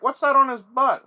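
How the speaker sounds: high, loud mocking voice of an idiot; loud, high-pitched dumb question; dumb guy. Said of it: "Some dumb motherfucker was like".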